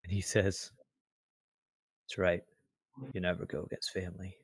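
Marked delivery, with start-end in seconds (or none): 2.0-4.4 soft, resigned agreement; downcast. Acted. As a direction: concede it quietly, looking down. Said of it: "Rev looks down".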